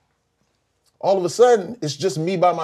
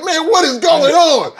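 amazed; 13 semitones higher, 7 dB louder, slower than usual. Retold or imitated. Retelling being thrown in mosh pit.

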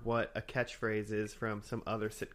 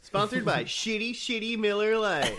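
laughing mock ad; 8 semitones higher, 6 dB louder than usual. Mock ad read.

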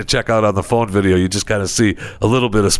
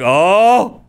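startled yelp; 10 semitones higher, 5 dB louder than usual. Retold or imitated. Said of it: "he's like".

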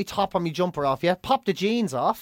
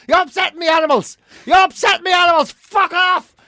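furious roar, much higher and louder; 10 semitones higher, 6 dB louder than usual. Retold or imitated. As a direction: roar them off your doorstep.

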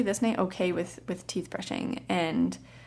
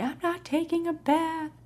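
put-on child refusing; 9 semitones higher than usual. Toddler refusing bath, voiced by teller.

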